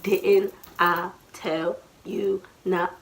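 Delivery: slow, separated beat on each word; word per hit. Shown as a demonstration. Mother scolding while hitting, word by word. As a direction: land each word like a smack. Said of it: "every hit is, like, a word".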